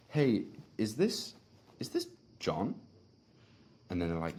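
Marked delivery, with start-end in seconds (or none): 0.1-2.8 hesitant, slow, puzzled opening; confused. Shown as a demonstration. Setter calling a lead, sounding lost and confused. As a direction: sound like you can't find the name. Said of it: "use your tone to sound confused".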